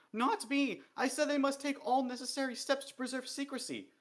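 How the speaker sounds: high, loud, desperate protest; panicked denial. A narrator's reading. Dee protests to the king, desperate and frightened. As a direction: insist on innocence in a rush of fear.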